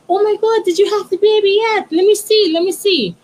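put-on woman in a grocery line excitedly asking to see the baby; excited gushing. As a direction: gush over the new baby, high and bright-eyed. Excited acquaintance asks about baby.

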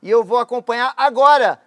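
high, loud cartoon hero voice; Goku voice. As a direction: shout it like an eager anime hero. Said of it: "eu sou o Goku". (voz do Goku empolgada)

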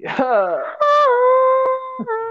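high-pitched squeal of laughter. Real, not acted. Laughing after the joke.

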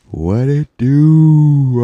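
loud, high, stretched-out catchphrase; drawn-out holler. Pretend. Podcaster repeating his catchphrase to listeners, long playful holler.

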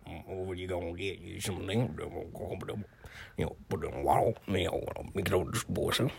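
soft, low mock Creole mumble. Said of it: "that's his Creole".

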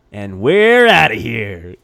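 loud, drawn-out goodbye; showy sign-off. Real, not acted. Cohost signing off the podcast, loud drawn-out farewell. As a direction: stretch it out big to close the show.

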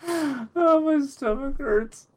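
breathless, high after laughing; after laughing hard. Real, not acted. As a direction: still gasping from laughter. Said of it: "I laughed too hard".

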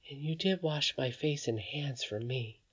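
put-on soft, tearful child voice; a little girl close to tears; beginning to cry. A narrator's reading. Irene hurt, speaking to grandmother, softly tearful. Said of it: "beginning to cry".

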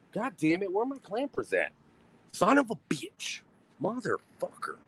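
high, strained swearing at lost tongs; cursing while hunting for the clampers; exasperated cursing. Real, not acted. Host can't find his clampers, swearing high and strained.

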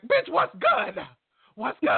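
high-pitched teasing imitation of a confrontation; hammed-up high confrontational challenge; mock crescendo. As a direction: squawk it way up high, mocking her challenge. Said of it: "you probably was crescendoing like".

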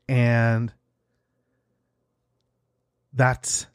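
ordinary talk, nothing marked

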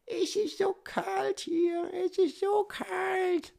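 very high, drawn-out mock falsetto; whiny roommate imitation. Roommate complains about the open window, slow high-pitched mock whine. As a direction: stretch it out in a squeaky put-on voice. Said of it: "Er so".

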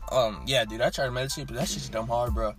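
normal talking voice

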